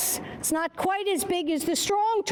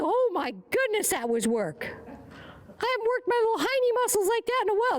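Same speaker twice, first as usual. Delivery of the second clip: higher, comic tired horse voice; voicing the horse; 7 semitones higher than usual. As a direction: play the horse complaining about sore muscles. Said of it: "He said".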